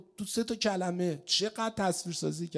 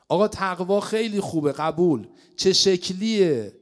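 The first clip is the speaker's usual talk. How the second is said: louder, pressing rhetorical question; preacher's forceful challenge; rising challenge; 3 semitones higher, 7 dB louder than usual. Real, not acted. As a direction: push the audience for an answer.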